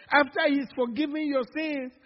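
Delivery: usual speaking voice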